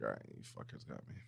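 resigned whisper. Retold or imitated. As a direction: whisper it, defeated. Resigned reply to staff.

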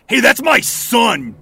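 high, slow bellowed outrage; angry shout. Acted. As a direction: yell it as you charge. Father charges at attacker.

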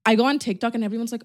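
normal talking voice

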